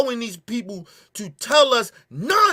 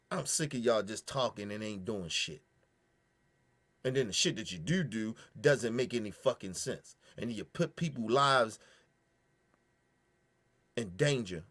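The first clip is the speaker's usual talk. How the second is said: low disgust; 12 semitones lower, 10 dB quieter than usual. Real, not acted. Quiet contemptuous dismissal.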